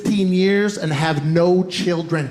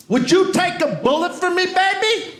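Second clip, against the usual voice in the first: much higher, almost squeaky plea; pleading high question; 10 semitones higher than usual. Retold or imitated. Husband asking his wife if she'd take a bullet, high pleading. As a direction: beg her sweetly, voice jumping up. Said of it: "And I said".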